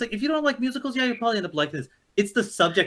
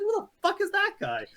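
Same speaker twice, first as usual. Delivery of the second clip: mock bafflement; 10 semitones higher, 4 dB quieter than usual. Mock reaction to the movie.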